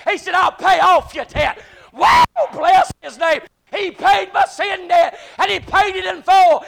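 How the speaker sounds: shouted, high, slow preacher climax; sermon peak, voice ringing high and loud; preaching at full force. Acted. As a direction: hoot it out to the rafters.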